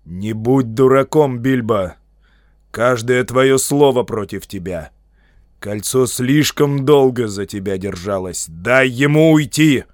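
loud, stern wizard's warning; commanding rebuke; sharp, almost menacing. A narrator's reading. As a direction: speak forcefully, close to a threat. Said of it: "заговорил резко, почти грозно".

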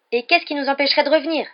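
loud, high, furious comeback; fiery retort. A narrator's reading. Ron answers Percy back, heatedly. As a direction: hit back hotly. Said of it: "répondit Ron avec fougue".

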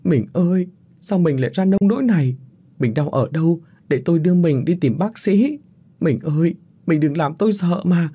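a wife weeping and begging her husband; bursting into tears. A narrator's reading. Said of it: "bỗng òa khóc lên".

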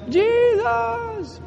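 high, stretched-out holy 'Jesus'; high drawn-out cry. Acted. Preacher imagines his finger healing, high drawn-out cry. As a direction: sing it out high, held long. Imitating the finger healed in heaven.

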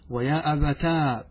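slow, long final aa on أبتا; with alif. Shown as a demonstration. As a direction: say أبتا as "abataaa". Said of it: "بالألف".

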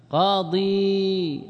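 قاضي with both long vowels stretched out slowly; قاضي said very slowly, long "qaa-diii"; drawn-out final ya. Shown as a demonstration. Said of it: "أين الياء؟".

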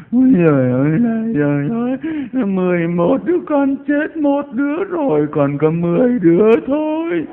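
high-pitched, loud wail, imitating a grieving mother; hammed-up keening over a dead child; mock funeral wailing. Mother wailing at her child's funeral, mock wailing. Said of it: "khóc như thế này".

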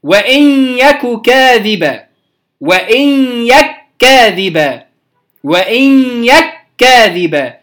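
وإن يك كاذبا with the kaf merged, slow; slow recited demo. Shown as a demonstration. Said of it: "مثال آخر".

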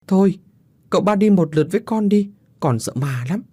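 put-on high whiny little boy begging; tearful pleading child. A narrator's reading. Little Hậu afraid of ghosts begs uncle, tearful pleading. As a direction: plead high, on the verge of tears. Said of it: "vừa mếu máo năn nỉ Du".

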